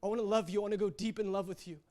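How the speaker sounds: soft, yearning prayer; crying out in prayer. Retold or imitated. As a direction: plead softly and urgently with God. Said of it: "And I'm crying out".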